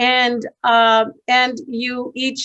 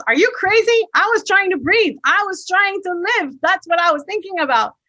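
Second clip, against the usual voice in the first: put-on indignant young man; 7 semitones higher than usual. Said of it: "The young man goes".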